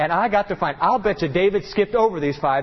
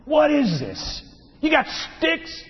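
mocking giant; 7 semitones higher than usual. Preacher voicing Goliath's mockery.

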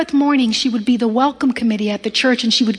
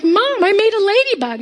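put-on happy child; 8 semitones higher than usual. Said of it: "I happily went home to my parents".

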